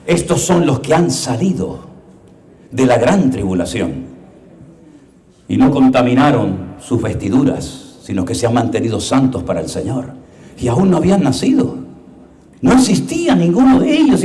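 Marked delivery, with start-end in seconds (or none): 0.1-10.1 quiet majestic voice of the Lord; solemn Lord. Acted. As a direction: speak it gravely and hushed. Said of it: "Y le dice el Señor".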